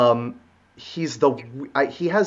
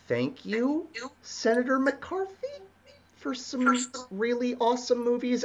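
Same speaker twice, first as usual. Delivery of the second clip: sarcastic; 9 semitones higher, 7 dB quieter than usual. Acted. Ironic thanks to a blacklister.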